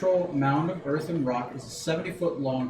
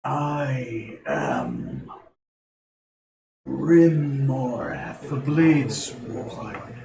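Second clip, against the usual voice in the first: booming dragon voice; much slower than usual. Acted. Dragon head announces itself.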